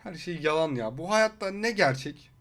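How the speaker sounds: mock dejection. Mock dejection after reveal.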